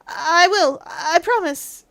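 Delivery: speaking up timidly. Acted. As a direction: push the voice up a little, obediently. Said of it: "do you think you could speak up".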